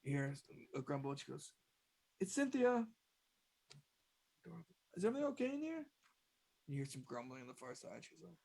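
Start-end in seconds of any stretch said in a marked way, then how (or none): 2.2-2.9 high-pitched announcing voice; secretary at the door. Acted. 4.9-5.9 high, rising inquiry; pitched-up voice, peeking in to check; checking-in secretary. Acted.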